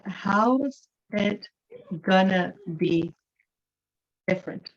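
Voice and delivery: "How's it gonna be different" spaced out, with pauses; casual Western contraction. Shown as a demonstration. Teacher quoting the student's question back, contracted and broken up by pauses. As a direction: slowly pick out "How's" and "gonna", reduced forms intact. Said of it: "Western English sentence".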